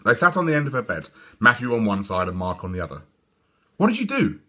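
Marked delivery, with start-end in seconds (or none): 3.8-4.4 high eager question; high curious boys; curious twins. A narrator's reading. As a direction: lean in curious. Twins ask.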